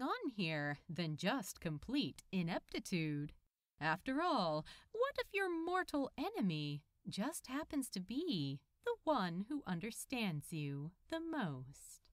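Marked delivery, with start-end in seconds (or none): none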